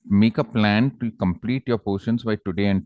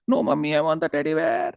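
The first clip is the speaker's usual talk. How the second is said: high-pitched tantrum whine; whining child; 8 semitones higher than usual. Acted. Small girl insists to her irritated mother, high mock wail. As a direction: imitate a small girl wailing in protest. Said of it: "she started crying".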